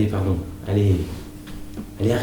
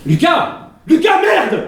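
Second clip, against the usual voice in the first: put-on high, loud exasperated shout at the child; furious yell; 4 dB louder, much slower than usual. Mother yelling at Lucas throwing a tantrum, furious shout. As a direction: scream his name to shut him up.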